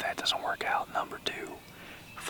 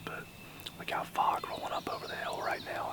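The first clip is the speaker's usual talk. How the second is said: usual speaking voice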